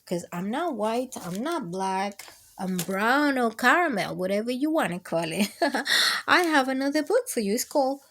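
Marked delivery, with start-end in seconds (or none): none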